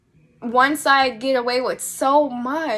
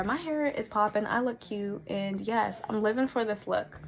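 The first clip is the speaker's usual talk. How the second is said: quiet self-admiring aside; 4 semitones lower, 9 dB quieter than usual. Real, not acted. Aside admiring her own look.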